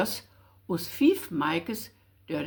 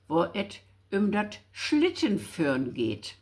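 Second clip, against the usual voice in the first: ordinary talk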